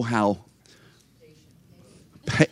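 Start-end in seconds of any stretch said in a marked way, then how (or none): none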